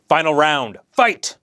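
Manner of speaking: big arena announcer call; booming fighting game announcer. Pretend. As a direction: boom it out like a fight game intro.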